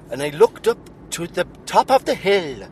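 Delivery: Welsh lilt drifting toward Irish; sing-song Welsh storyteller, slow at end; attempted Welsh accent. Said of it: "your version of Irish".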